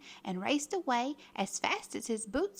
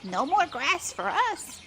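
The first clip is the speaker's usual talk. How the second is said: higher, eager herd voice; cattle's cry; 4 semitones higher, slower than usual. A narrator's reading. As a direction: cry out for a treat. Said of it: "they all cried".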